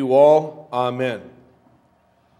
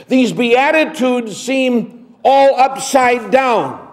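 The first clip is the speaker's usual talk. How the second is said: higher and louder, an urgent pulpit delivery; full-force preaching; 8 semitones higher, 4 dB louder than usual. Acted. Pastor drives home the Beatitudes to congregation, preaching. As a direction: preach it out to the whole church.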